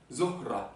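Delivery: Zohra with the H breathed in the middle; Zohra, H clearly pronounced. Shown as a demonstration. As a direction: sound the H between zoh and ra. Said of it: "le H au milieu du mot se prononce".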